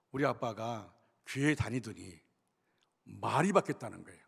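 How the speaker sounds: normal talking voice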